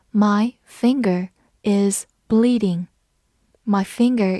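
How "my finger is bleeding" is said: slow and separated, word by word; slow read-along. Shown as a demonstration. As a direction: read slowly so learners can repeat. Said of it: "我们慢慢来读". (慢速逐词领读)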